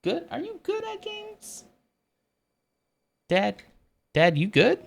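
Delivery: squeaky little-kid impression of a teasing son; son's voice. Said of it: "Like that".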